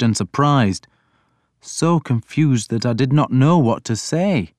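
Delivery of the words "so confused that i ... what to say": ordinary delivery, nothing marked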